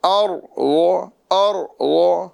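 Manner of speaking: ار و drawn out with a thick ر and heavy ض; full heavy ra. Shown as a demonstration. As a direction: say ار و slowly, filling the mouth on ر. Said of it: "را کو پر کریں گے".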